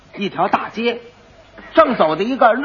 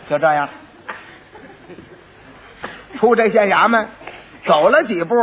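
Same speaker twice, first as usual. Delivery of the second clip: ordinary talk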